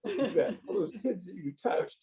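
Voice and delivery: choked up, holding back tears; getting emotional. Real, not acted. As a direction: voice breaking with emotion, rising high. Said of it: "I don't know why I'm getting emotional".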